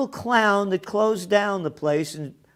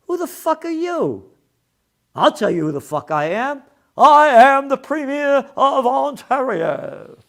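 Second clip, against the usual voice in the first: high, loud pompous bluster; mocking Doug Ford; 8 semitones higher, 7 dB louder than usual. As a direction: bellow it like a bully. Mocking Ford's arrogance.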